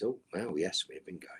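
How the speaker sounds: ordinary delivery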